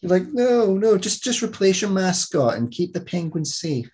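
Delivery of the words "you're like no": ordinary delivery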